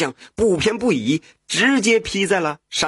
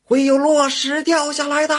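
calling out loud; 12 semitones higher, 4 dB louder than usual. A narrator's reading. Woodcutter shouts to hunters about falling rocks, high and loud. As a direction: yell the warning up the mountain at full voice. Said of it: "一边走一边喊".

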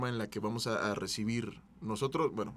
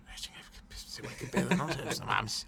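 whispered retort; 6 dB quieter than usual. Retold or imitated. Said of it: "me lo dices tú".

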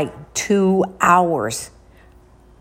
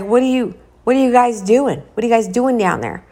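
louder demanding father voice; angry dad; 4 semitones higher, 5 dB louder, faster than usual. Retold or imitated. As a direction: bark it like a dad catching his kids. Dad catches sons at basement.

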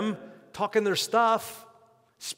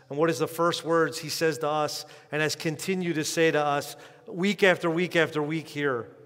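ordinary talk, nothing marked

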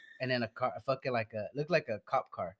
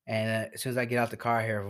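ordinary talk, nothing marked

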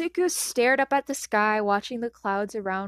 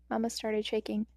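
usual speaking voice

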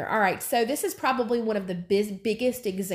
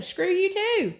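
mock retort; 9 semitones higher, slower than usual. Flesh wants to lash out.

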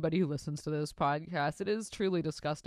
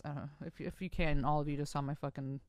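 usual speaking voice

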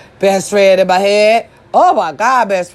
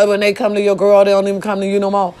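ordinary talk, nothing marked

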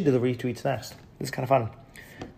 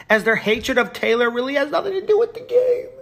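high, nasal-free mock hater voice, much higher; mocking whiny voice; 12 semitones higher than usual. Streamer mocking a hater's comment in a high voice. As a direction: parody the commenter in a high silly voice.

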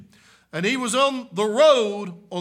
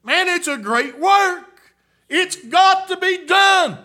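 raised, loud preaching cry; preaching at full force; 10 semitones higher, 7 dB louder than usual. Acted. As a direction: lift it up like a revival preacher.

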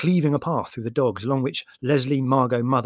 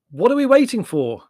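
loud, high-pitched impatient demand; irritable; 11 semitones higher, 9 dB louder than usual. A narrator's reading. Larry, seated in the cab, asks irritably. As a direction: demand it testily, voice raised. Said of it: "he looked round irritably".

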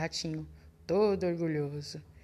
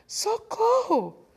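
much higher, louder, slow wailing cry; terrified shout; 6 dB louder, much slower than usual. Acted. Fox spotting the gruffalo, high slow wailing shout. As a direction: scream for help as a panicking fox.

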